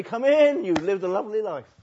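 high, loud hammed-up voice of God; mock God welcoming. Preacher mocking God's welcome to the self-satisfied, hammed-up. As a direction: play God waving him in, sugary and grand. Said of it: "God will say".

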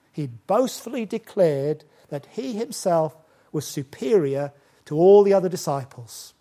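usual speaking voice

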